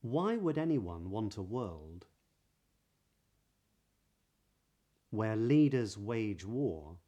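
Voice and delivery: measured reading with rounded W in "Why would anyone want"; practice sentence. Shown as a demonstration. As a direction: round each W of "wage war", long pauses between phrases. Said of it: "here are some sentences".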